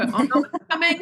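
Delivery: high-pitched mock dread of tears; mock panic. Retelling the lump before crying, in mock panic. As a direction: play up the panic as tears well up. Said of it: "it was this horrible lump, and, and I'd go".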